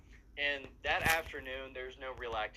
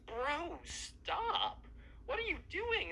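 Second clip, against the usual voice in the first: far higher, strained protest; squeaky mock panic; much slower than usual. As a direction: squeal it while pinned to the ground. [name] tackled by emo kid.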